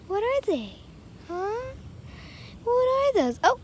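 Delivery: high, playful questions to a kitten. Real, not acted.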